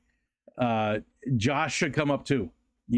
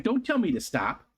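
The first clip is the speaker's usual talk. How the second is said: voicing the dog; 8 semitones higher than usual. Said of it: "He's like".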